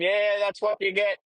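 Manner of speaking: high, gloating mock yell; triumphant yell, pitched well up; retold yell. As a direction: shout it after the fleeing goat. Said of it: "I'm fucking screaming at him".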